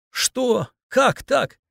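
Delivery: put-on high-pitched, distressed young woman's questions; tearful shock. A narrator's reading. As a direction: blurt it in dismay, close to tears. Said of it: "На глаза Алины накатывались слёзы".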